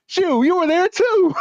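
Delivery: high, slow teasing retort; mock comeback. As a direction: drag it out like a gleeful gotcha. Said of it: "He said".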